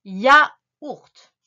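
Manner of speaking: YAOURT very loud, split ya - ourt; dictation. Shown as a demonstration. Teacher dictates the word to write, loud syllable-by-syllable. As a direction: punch out each syllable of YAOURT. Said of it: "tu écris".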